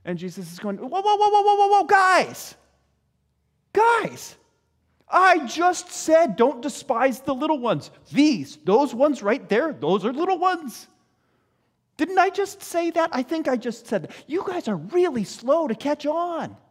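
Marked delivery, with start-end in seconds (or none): 0.8-4.3 high-pitched, loud string of whoas; acted high yelp of protest; exasperated halt. Jesus halting the disciples. 5.1-16.6 raised pitch, emphatic incredulous reminder; acted exasperated teacher; exasperated scolding. Jesus scolding the slow disciples.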